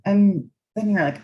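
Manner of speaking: ordinary talk, nothing marked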